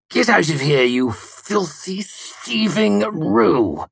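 venomous. A narrator's reading. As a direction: spit out each insult with venom. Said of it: "he spat venomously".

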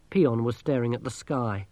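ordinary delivery

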